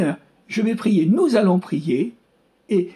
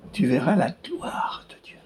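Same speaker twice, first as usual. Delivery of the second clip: hushed whisper; 3 semitones lower, 4 dB quieter than usual. Retold or imitated. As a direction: drop to a soft whisper. Quoting Jesus in sermon.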